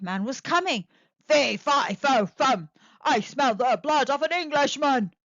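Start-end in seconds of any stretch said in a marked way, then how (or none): 1.3-5.1 loud booming giant chant; giant roaring. A narrator's reading. Giant roars his chant at the kitchen, loud. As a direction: roar it out like a hungry giant. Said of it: "the giant roared".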